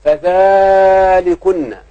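long, loud, high held vowel; drawn-out quotation. Shown as a demonstration. Teacher quoting the governor's wife to pupils, drawn-out emphasis. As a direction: stretch it out slowly and emphatically. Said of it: "امرأة العزيز تقول للنسوة".

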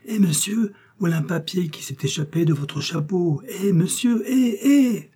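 voix de garçon aiguë, qui appelle de plus en plus haut; garçon d'écurie qui crie. A narrator's reading. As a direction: courir en hélant le cavalier. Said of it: "s'écria un garçon d'écurie". (le garçon d'écurie court après l'inconnu en criant, de plus en plus aigu)

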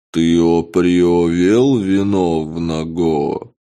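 slow, stretched, alien creature croak; drawn-out murloc voice. Acted. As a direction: drag every sound out like a fish-man. Murloc envoy questions hero.

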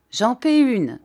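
loud, high offer of a round; declaring. A narrator's reading. Tourneveau offering a bottle, loud declaration. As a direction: boast it to the room. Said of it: "déclara monsieur Tourneveau".

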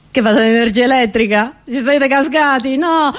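ordinary talk, nothing marked

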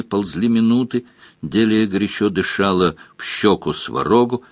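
ordinary delivery

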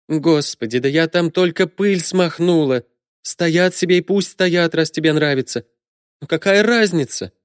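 high-pitched, put-upon mother; mother brushing off a fussy complaint; exasperated mother. A narrator's reading.

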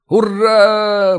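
loud high hurrah; cheering. A narrator's reading. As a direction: shout the hurrah. Soldiers cheer.

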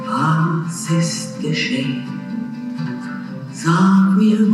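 ordinary delivery

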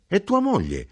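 mother bursts out; exclaimed. Acted. Mother to son, exclaiming high about his wife. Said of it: "esclamò mia madre".

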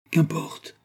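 narrated flash of anger; angry. A narrator's reading. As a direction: brush it off with a touch of anger. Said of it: "dit-il avec colère".

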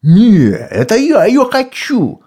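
shouting bat. A narrator's reading. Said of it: "закричал Сифон".

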